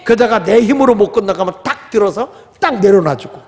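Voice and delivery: ordinary talk, nothing marked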